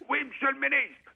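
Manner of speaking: high, clipped soldier's reply to a superior; soldier answering. Retold or imitated. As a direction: bark it up high like a para at attention. Para answers minister.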